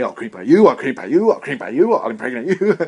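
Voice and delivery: loud, leering creep voice; creepy stage act. Pretend. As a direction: menace the crowd like a sleazy comic. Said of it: "And just say".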